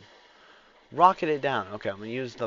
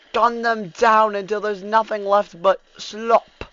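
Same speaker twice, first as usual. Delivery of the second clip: loud commander urging troops; rallying shouts; 12 semitones higher, 16 dB louder, slower than usual. Pretend. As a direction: shout orders to the men.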